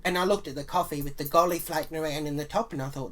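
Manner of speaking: normal talking voice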